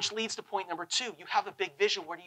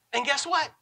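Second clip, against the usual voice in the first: ordinary talk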